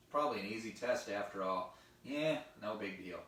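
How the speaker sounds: low, unimpressed shrug of a voice; belittling indifference; dismissive. Acted. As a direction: wave it away in a low tone. Boy belittles another's test mark.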